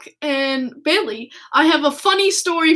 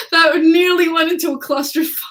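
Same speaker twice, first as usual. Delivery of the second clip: laughing; 2 semitones higher than usual. Real, not acted. Fan laughing at the near disaster. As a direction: chuckle through it.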